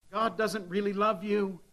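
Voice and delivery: put-on devil lying to children. Devil whispering lies to grieving children, sly and soft. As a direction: coax the children with a cruel hush. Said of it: "He roars to the children".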